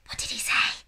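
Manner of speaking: unvoiced whispered question. A narrator's reading. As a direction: whisper it so the man can't hear. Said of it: "Sophie whispered".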